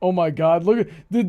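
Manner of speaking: usual speaking voice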